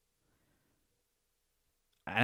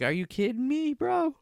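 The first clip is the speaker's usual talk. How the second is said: squeaky much higher mock disbelief, rising to a yelp on bro; outraged neighbour; 13 semitones higher than usual. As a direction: play the neighbour in high indignant disbelief. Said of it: "he's like".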